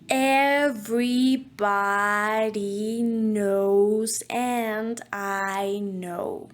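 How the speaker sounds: slow motion, each word stretched long. Shown as a demonstration. Voice coach repeating the phrase in slow motion. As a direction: pull every vowel out like slow motion. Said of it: "Again, very slow".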